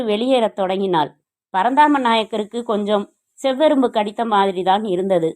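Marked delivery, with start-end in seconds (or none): none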